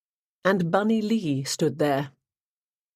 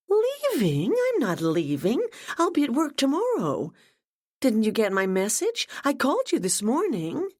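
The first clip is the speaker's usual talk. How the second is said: put-on much higher, bubbly young woman's voice; gushing Ashley; 8 semitones higher than usual. A narrator's reading. Ashley greets her boss Gemma, gushing and much higher. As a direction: gush with delight over the news.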